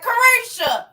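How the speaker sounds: Carisha called out high and loud with a falling end; loud teasing call of Carisha; yelled name. Shown as a demonstration. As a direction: holler Carisha like a family member. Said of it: "I sure be saying it like that".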